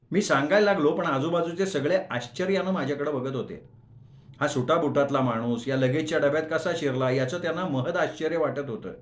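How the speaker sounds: plain reading voice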